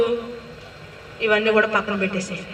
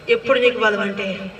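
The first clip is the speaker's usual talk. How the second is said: ordinary talk, nothing marked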